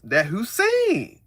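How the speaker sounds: mock emphasis, slowly leaning on "Hussein"; slow stressed Hussein. Mocking the heavy stress on "Hussein". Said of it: "they really put emphasis on that".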